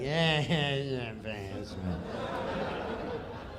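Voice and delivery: low, soft, breathy shrug; mumbled Norm. Retold or imitated. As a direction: mumble it off without caring. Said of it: "he's just looking at him like".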